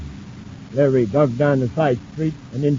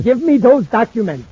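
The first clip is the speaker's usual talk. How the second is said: loud, higher demand at gunpoint; gun-point demand; 10 semitones higher, 6 dB louder than usual. Acted. Hall at gunpoint demanding the documents, loud and threatening. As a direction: bark the order.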